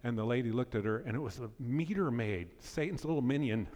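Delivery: ordinary delivery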